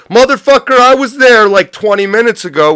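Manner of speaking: sudden loud shout of protest; angry outburst. Real, not acted. Ranting streamer shouts at viewers, loud and high. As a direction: explode at them, loud and high.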